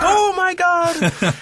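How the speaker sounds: high, slow amazed yell; excited shout. Retold or imitated. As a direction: shout it like a stunned fan. Friends reacting at viewing party.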